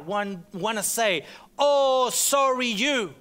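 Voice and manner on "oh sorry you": a pleading, self-pitying voice, louder and higher. Acted. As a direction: let the heart whine for pity. His heart voicing self-pity.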